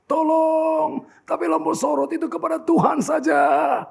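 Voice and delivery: high, insistent pleading cry to God; fervent prayer. Retold or imitated. Famous preacher begs God to move the spotlight, crying out. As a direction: plead loudly, building in force.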